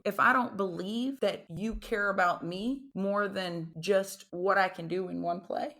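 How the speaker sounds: ordinary talk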